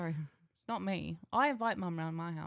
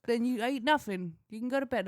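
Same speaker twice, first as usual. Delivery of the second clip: stern parent; 5 semitones higher, 5 dB louder than usual. Retold or imitated. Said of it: "And I went".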